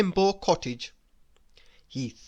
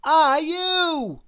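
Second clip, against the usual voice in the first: high, loud astonished question; raised, incredulous character voice; amazed splutter; 10 semitones higher, 6 dB louder than usual. A narrator's reading. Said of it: "he managed to splutter".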